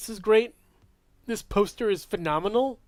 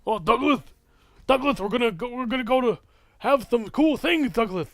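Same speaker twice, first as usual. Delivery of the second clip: Mister Dink voice; 3 semitones higher, 3 dB louder than usual. Retold or imitated. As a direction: pitch grand schemes with goofy enthusiasm. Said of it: "Mister Dink".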